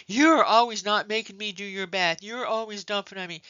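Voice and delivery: high, loud whining complaint; whiny coworker. Retold or imitated. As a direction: play the bitter coworker griping. Nurse voicing a complaining coworker.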